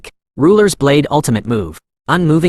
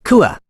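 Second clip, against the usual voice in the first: distant scream; 8 semitones higher than usual. A narrator's reading. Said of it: "Warriors screamed in the distance".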